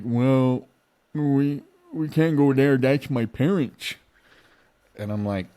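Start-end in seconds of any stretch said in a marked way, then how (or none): 0.0-3.9 put-on higher, louder drunk whine; drunk passenger. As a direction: protest like a drunk guy. Drunk passenger refusing the address.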